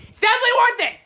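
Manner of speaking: high, loud insistence; excited shout. Real, not acted. Youtuber urging viewers to buy the movie, excited shout.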